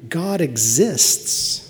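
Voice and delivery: slow, weighty, each word set apart; solemn proclamation. Real, not acted. Preacher declaring to the congregation, slow and solemn. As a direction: let God exists land slowly and gravely.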